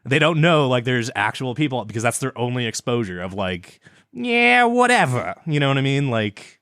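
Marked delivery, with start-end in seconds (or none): 4.1-5.4 high cartoon wisecracker with Brooklyn accent; Bugs Bunny. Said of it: "That's just a Bugs Bunny character voice".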